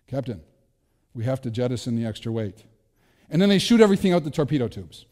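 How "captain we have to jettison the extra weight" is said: emotionless Spock. Retold or imitated. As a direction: state it with no feeling at all. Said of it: "who has no emotion or anything".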